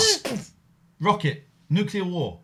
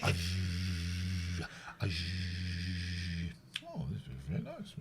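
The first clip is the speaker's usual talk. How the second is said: silent film muttering; 14 semitones lower, 15 dB quieter, much faster than usual. Acted. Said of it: "I'm doing, like, the muttering".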